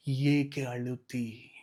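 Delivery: slow, low whisper of ಏಕೆ ಅಳುತ್ತಿ; tender hushed asking; whispered question. Acted. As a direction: lean in and whisper it slowly. God's question, intimately repeated.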